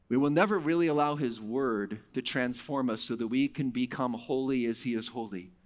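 normal talking voice

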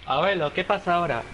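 usual speaking voice

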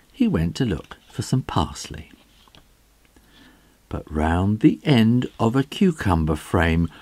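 ordinary delivery, nothing marked

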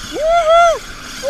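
high, loud joyful woohoo; excited whoop. Real, not acted. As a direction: let out a big happy cheer. Celebrating a fish on the line.

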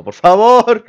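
high, loud wail of protest; exasperated pleading. Real, not acted. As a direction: beg loudly, pitch shooting up. Begging that there be no puzzles.

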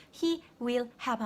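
HE WILL HAVE with each word fully sounded; separate full words. Shown as a demonstration. Teacher showing the tiring way, uncontracted he will have. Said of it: "너무 아프거든요".

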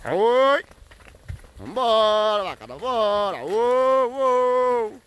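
high herding calls; high calls urging the herd to move; calling the cows on. Real, not acted. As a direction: call the cows to come along.

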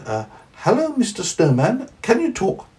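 put-on high, wide-eyed little boy. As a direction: ask it like a curious small child. Parent voices Johnny.

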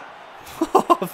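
high burst of breathy laughing after the cheer; shouted laugh. Real, not acted. Gamer laughing right after his own goal, with a high shouted burst of laughter. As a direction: let the laugh explode out.

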